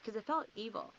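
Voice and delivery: usual speaking voice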